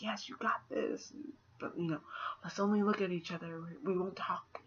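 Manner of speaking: hushed whisper. Retold or imitated. Meg and Calvin whispering encouragement, hushed. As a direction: whisper it like a secret pact. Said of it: "they're just like".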